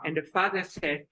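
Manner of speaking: normal talking voice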